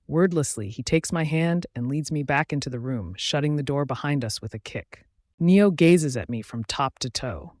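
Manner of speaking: ordinary delivery, nothing marked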